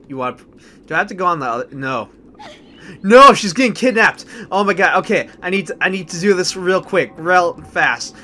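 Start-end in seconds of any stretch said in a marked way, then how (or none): 3.0-8.2 loud, high cry of alarm, then urgent rush; panicked. Real, not acted. Player sees companion being kidnapped, panicked. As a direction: panic as it suddenly goes wrong.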